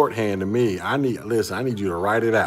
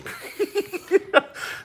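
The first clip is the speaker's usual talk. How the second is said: laugh. Real, not acted. Laughing at icky acronym.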